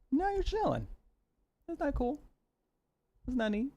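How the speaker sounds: very high, soft cooing; very high cooing to the settled cat. Real, not acted.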